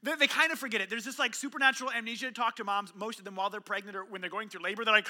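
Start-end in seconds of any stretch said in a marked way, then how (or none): none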